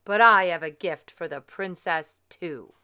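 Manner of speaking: loud, menacing wicked fairy. Acted. Wicked fairy to the king's guests, loud and menacing. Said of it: "The wicked fairy smiled a terrible smile".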